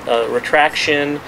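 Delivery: normal talking voice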